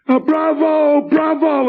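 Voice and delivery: very high, slow drawn-out bravo; lazy Italian manager. Retold or imitated. Comedian mimics his Italian manager, drawn-out high bravo. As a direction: lazily cheer like a bored Italian guy in Brooklyn. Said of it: "sits around Brooklyn going".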